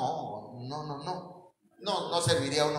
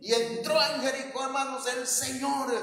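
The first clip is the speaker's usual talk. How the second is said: higher, sing-song preacher imitation; a hint of a hollering revival preacher; mock shouting preacher; 7 semitones higher than usual. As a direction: ham up an over-excited preacher. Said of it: "andaba predicando y gritando".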